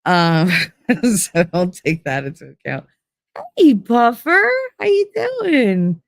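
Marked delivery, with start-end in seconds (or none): 3.6-6.0 high, slow, drawn-out hello; sing-song greeting. Real, not acted. Streamer greets viewer Puffer in a high, slow, cooing tone. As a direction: stretch it high like cooing at a friend.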